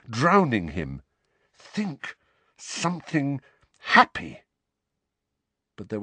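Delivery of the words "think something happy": halting, strained words with gaps; high, faint start, broken phrasing; desperate self-urging. A narrator's reading. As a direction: force out each word while struggling. Harry desperately urges himself while drowning.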